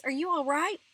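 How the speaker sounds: high, loud, anxious question; scared voice. A narrator's reading. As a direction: check on someone in alarm. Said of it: "Mama asked in a scared voice".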